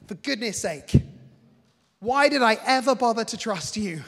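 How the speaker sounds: mock an angry rebuke; exasperated rebuke. Jesus imagined scolding Peter, loud exasperated rebuke. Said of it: "he's not like".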